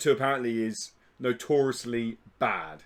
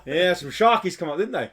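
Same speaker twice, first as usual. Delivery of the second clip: higher, louder hammed Australian; mock Australian accent; 5 semitones higher, 5 dB louder than usual. Telling his mate of sharkies, mock Australian. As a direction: play a lively Aussie bloke. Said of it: "The classic Australian term".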